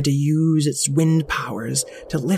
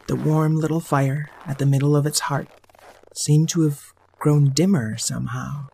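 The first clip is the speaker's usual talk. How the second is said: ordinary delivery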